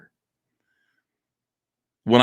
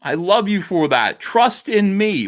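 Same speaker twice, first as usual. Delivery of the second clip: normal talking voice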